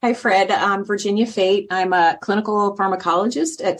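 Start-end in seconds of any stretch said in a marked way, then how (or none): none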